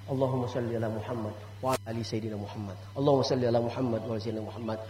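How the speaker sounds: low, soft chanted salawat; recited salawat. Shown as a demonstration. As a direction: chant it gently as to a child. Demonstrates salawat to grandchild. (melantunkan salawat pelan dan rendah)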